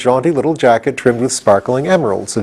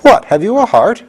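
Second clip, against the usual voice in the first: surprised; 12 semitones higher, 4 dB louder than usual. A narrator's reading. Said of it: "asked the Scarecrow, surprised".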